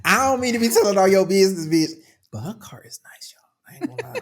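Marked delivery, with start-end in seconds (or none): 2.3-3.4 hushed whisper. Real, not acted. Gossiping to listeners about friend's car in a whisper. 3.7-4.2 breathy whisper. Real, not acted. Admitting to listeners she loves friend's car, whispered.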